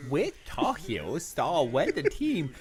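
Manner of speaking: put-on stammering babyish read, squeaky breaks; baby talk. Asked to talk like a baby, host stammers the question babyishly. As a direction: stumble through it like a toddler. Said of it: "Talk like a baby".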